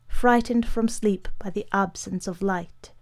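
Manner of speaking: plain reading voice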